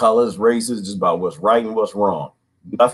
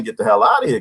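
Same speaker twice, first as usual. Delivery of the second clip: ordinary talk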